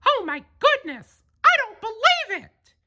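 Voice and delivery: very high, amazed then reassuring cartoon mouse; Mickey Mouse promising to rescue a kitten; Mickey's voice. Mickey sees kitten in tree.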